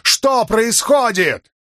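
very high, stretched-out cry; shouting. A narrator's reading. Man demands answers of the sky, high shout. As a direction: yell it up at the sky. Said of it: "прокричал Халин, обращаясь к небу".

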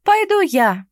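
high, loud bright offer; eager volunteering. A narrator's reading. Mermaid offering to go instead, eager.

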